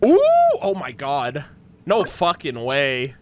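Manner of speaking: ordinary talk, nothing marked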